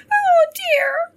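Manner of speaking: very high, loud squeaky hare voice; tiny creature's falsetto wail; little hare crying. A narrator's reading. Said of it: "she cried".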